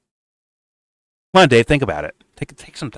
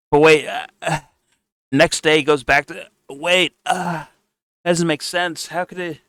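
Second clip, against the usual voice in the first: mock confused Dave; 8 semitones higher than usual.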